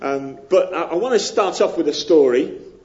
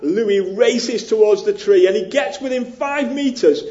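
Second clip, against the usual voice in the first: ordinary talk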